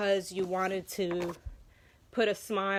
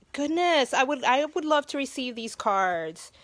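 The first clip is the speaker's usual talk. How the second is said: higher, louder gushing; gushing delight; 6 semitones higher, 6 dB louder than usual. Real, not acted. Admiring a fan's artistic card, higher louder gush. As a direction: let the excitement spill over.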